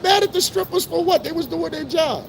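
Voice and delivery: high-pitched incredulous retort, as if it's obvious; mocking high voice. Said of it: "I said".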